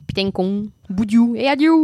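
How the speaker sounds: put-on slow, then a loud southwest French accent on boudiou; mock southwest accent. Showing the family's south-western French accent, mock. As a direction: toss off southwest slang with a thick south-western twang. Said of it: "l'accent du sud-ouest".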